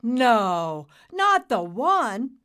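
loud, slow, rising disbelief; awed disbelief. Acted. Nimbus realising who Dad met, awed. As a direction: draw it out in amazement.

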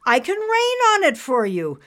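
proud and showy. Acted. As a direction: boast brightly as a cartoon cloud. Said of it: "Nimbus said with pride".

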